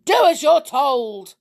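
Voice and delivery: high, loud, angry order; commanding. A narrator's reading. Stepfather getting angry with a disobedient boy, commanding. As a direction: cut off the argument with a command. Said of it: "commanded Mister Lenoir".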